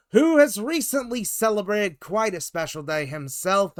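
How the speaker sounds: ordinary delivery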